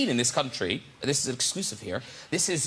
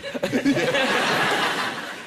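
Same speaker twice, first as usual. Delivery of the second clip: high, loud burst of laughter; host laughing at the guest's story; 10 semitones higher, 4 dB louder than usual. Real, not acted. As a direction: laugh along.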